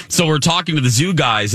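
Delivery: ordinary talk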